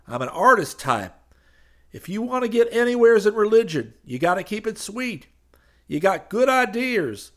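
loud, high glad-handing preacher voice; high preacher figure. Acted. Street preacher pitching to the crowd, loud and high. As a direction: boom it out like a street preacher selling salvation.

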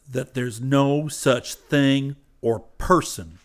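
firm, curt rural voice; stern young preacher. A narrator's reading. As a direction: push him off coldly. Hayes voiced, slow.

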